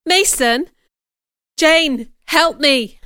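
high, loud plea for help; alarmed exclamation. A narrator's reading. Rochester turns to Jane for help, alarmed exclamation. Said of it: "he exclaimed, his face becoming pale".

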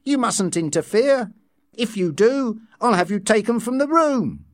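high-pitched firm scolding; stern telling-off. A narrator's reading. Nurse warning someone not to interfere, stern.

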